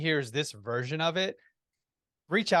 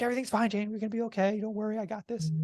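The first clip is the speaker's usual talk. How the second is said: high-pitched retold reassurance; anxious reassurance; 9 semitones higher, faster than usual. Husband caressing wife's head as he drives, high reassurance. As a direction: keep reassuring in a raised, hurried pitch. Soothes wife while driving.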